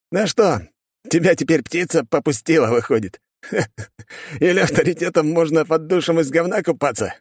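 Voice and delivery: high-pitched, jeering guard voice; teasing exclamation. A narrator's reading. Guard mocks his mate hit by bird droppings, jeering. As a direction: taunt your mate with relish. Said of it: "воскликнул он".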